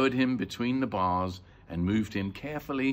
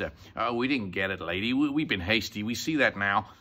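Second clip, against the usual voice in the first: usual speaking voice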